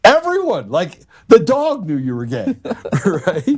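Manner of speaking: laughing through it, voice pitched high. Real, not acted. Telling the podcast host the story, laughing hard. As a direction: laugh as you tell it, pitch climbing up.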